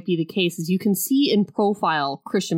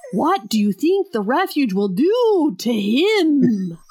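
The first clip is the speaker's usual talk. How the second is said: slow, higher mock Russian accent; 6 semitones higher, 3 dB louder, slower than usual. Duvall's line retold to a friend, slow mock Russian accent. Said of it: "He says it with a straight Russian accent".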